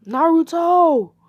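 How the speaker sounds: high, loud, drawn-out yell of the name; scolding shout. Acted. Angry mother yells at her son, cracking shout. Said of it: "Voice crack".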